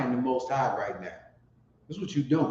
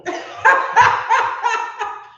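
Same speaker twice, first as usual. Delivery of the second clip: loud high-pitched laugh; real high peal of laughter; 7 dB louder than usual. Real, not acted. Amused at the remark about chastising.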